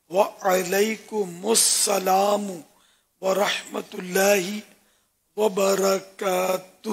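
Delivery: وعلیکم said wa-alaikum with the ain clearly voiced, very slow; correct pronunciation. Shown as a demonstration. Scholar teaches the correct reply to salam, slow and exact. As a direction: stretch out وعلیکم السلام ورحمۃ اللہ وبرکاتہ, the ain on وعلیکم with a clear a. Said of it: "عین پر بھی زبر ہے".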